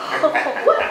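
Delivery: gasping laughing what; shocked gasp. Real, not acted. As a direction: gasp in mock outrage. Shocked by the swear.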